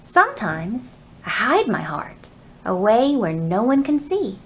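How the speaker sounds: plain reading voice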